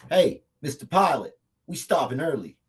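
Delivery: shouting up to the cockpit; loud call across the plane. Acted. Calling out to the pilot.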